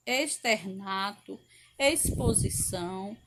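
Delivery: each word read with the x sounding like s. Shown as a demonstration. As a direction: read the list pronouncing every x like s. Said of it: "X com som de S". (lista lida com X com som de S)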